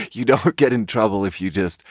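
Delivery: usual speaking voice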